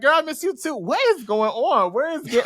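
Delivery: put-on high, gushing girlfriend voice; excited catching up. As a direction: answer with hammed-up excitement. Storm answers Jean in retelling.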